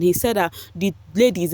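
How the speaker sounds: ordinary delivery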